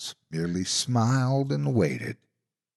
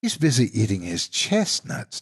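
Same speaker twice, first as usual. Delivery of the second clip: sly monster voice; 3 semitones higher than usual. Acted.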